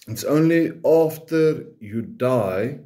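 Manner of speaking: normal talking voice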